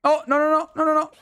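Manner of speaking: high, alarmed string of no; panicked no's. Real, not acted. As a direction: panic as you get caught. Enemy attacks, he panics. (no, no, no alarmado y agudo)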